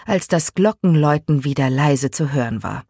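ordinary delivery

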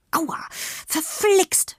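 slow, high-pitched groan of pain. A narrator's reading. Emma has just twisted her foot, groaning in pain. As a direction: groan it out, drawn long and high. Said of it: "stöhnte sie".

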